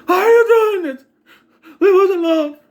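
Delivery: very high, loud desperate plea; convincing performance. Acted. Babysitter begging the stalker to stop, high desperate cry. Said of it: "That's more convincing than what she was doing".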